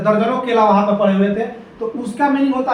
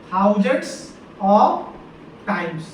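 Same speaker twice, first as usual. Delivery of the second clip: word-by-word dictation; much slower than usual. Shown as a demonstration. Teacher writing out the corrected phrase, word-by-word dictation. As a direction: dictate slowly, piece by piece, trailing down.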